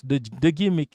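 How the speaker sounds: ordinary delivery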